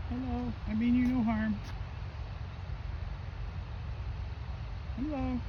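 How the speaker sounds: soft, high coaxing voice; talking to an animal. Real, not acted. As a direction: coo gently at a shy deer. Speaking gently to a deer.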